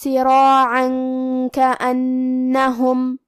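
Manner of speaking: سراعا کأنہم, the tanween hidden into the kaf with a nasal hum; slow chanted recitation, nun hidden and hummed; ikhfa with ghunna. Shown as a demonstration. Teacher demonstrates ikhfa to students, slow chant. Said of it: "تنوین کو چھپاتے ہوئے اور غنہ کے ساتھ".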